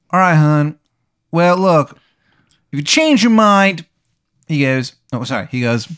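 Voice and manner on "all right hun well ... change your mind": louder, raised bad Baltimore accent; bad Maryland accent. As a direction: lay the awful Maryland accent on thick. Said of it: "this guy's accent sounds like shit".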